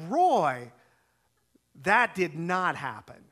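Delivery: loud, slow, emphatic retold denial; indignant denial. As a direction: deny it firmly, each word weighted. Firmly denies the retold accusation.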